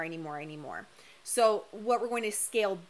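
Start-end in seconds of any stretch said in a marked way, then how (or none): 0.0-0.9 needy craving fading to a hush, lower; fading needy murmur. Retold or imitated. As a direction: let the craving trail off, much softer. Quoting grasping, needy conditioning.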